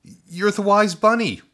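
high, loud joyful exclaim; amazed cry. A narrator's reading. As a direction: burst out in wonder. Boy recognizes the wise bunny.

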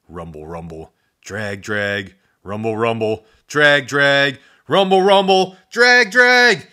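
rising frantic chant; building chase. A narrator's reading.

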